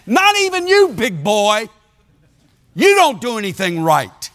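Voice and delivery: high, driving preacher's voice, punching each verse; full-force preaching. Retold or imitated. Preacher hammering scripture at Willard, high and forceful. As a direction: hammer the scripture home at him.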